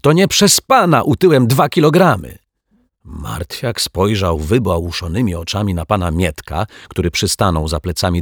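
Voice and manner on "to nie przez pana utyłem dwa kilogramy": a high, loud, eager yes; bursting with excitement; a thrilled, louder, higher reply; excited confirmation. Acted. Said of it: "potwierdził rozemocjonowany".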